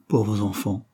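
usual speaking voice

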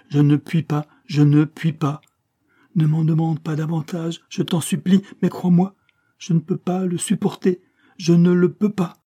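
high, loud, urgent pleading; insistent, overwrought plea; desperate supplication. A narrator's reading. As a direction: beg at the end of endurance. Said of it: "les mains levées en guise de supplication".

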